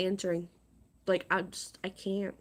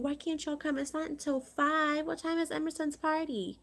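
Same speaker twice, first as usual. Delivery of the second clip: higher, mocking read of her text; mocking the sender; 8 semitones higher than usual. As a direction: read it back in a higher, scornful voice. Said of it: "I just got a text message from her saying".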